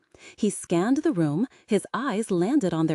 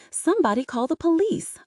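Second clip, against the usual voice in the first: high, urgent call for help; a bystander shouts for help; shouted; 9 semitones higher than usual. A narrator's reading. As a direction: cry out over the chaos. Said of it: "a voice shouted".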